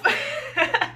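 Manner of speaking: voiceless high laugh; breathy laugh. Real, not acted. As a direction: laugh under your breath. Laughing at own reading.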